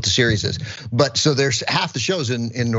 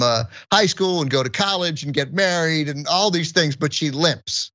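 incredulous sarcasm; 7 semitones higher than usual. Retold or imitated. Incredulous retold reply to mom.

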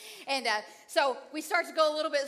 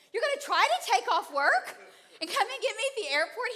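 laughing disbelief; 6 semitones higher than usual. Retold or imitated. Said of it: "I said".

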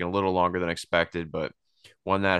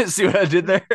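laughing; 12 semitones higher, 6 dB louder than usual. Real, not acted. Laughing at his own joke.

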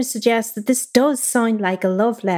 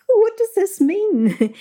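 high, drawn-out puzzled question; exaggerated, slow, high-pitched confusion; mock bafflement; 11 semitones higher, slower than usual. Letter researchers puzzling over an obscure reference, mock bafflement.

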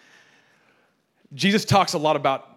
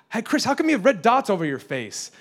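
put-on high-pitched, curious child voice; imitated blunt kid; 7 semitones higher than usual. Kid at Bible school to the teacher, imitated blunt kid. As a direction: ask it like a tactless little kid.

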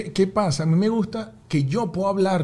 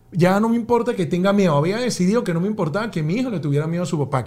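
ordinary talk

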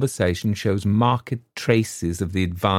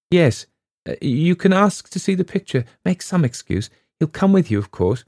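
breathless plotting; 8 semitones higher, 4 dB louder than usual. A narrator's reading. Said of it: "she gasped".

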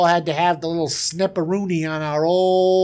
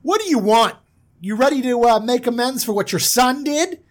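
louder, demanding challenge; confronting brothers; 6 semitones higher, 4 dB louder than usual. Retold or imitated. Brothers confront the king, louder demand. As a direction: push them for amends. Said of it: "And they go".

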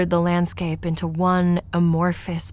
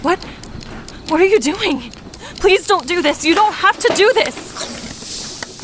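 high, loud, desperate begging; frantic, breaking pleas as they close in; panicked pleading; 12 semitones higher, 5 dB louder than usual. Acted. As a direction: plead for your life in terror. Begging captors not to hurt.

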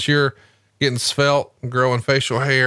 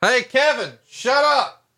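mock yell; 12 semitones higher, slower than usual. Yelling at background voice.

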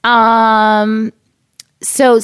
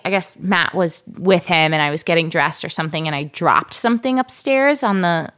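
normal talking voice

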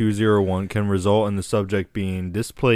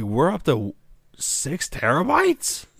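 surprised; 6 semitones higher, slower than usual. Retold or imitated.

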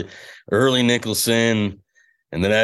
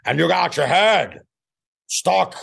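Pacino impression; 7 semitones higher, slower than usual. Shown as a demonstration. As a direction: build into screaming Pacino. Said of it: "What's your best Pacino line".

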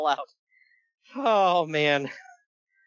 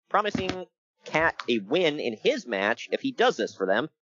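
ordinary talk

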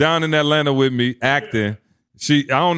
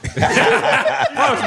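laughing. Real, not acted. Laughing while urging her.

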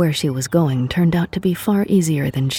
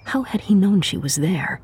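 ordinary delivery

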